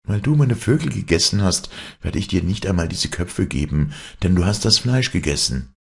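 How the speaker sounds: put-on storybook child airing a grievance; reproachful trickster son. Hero reproaches his mother.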